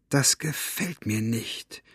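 slow, hushed aside; whispering. A narrator's reading. Elinor confiding her distrust to Maggie, lightly whispered. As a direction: murmur it softly and slowly, half whispered. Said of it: "flüsterte Elinor".

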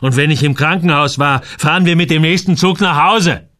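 loud, climbing angry command; bellowed order. A narrator's reading. Samuel orders Joel home by train, bellowing. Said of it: "brüllte er".

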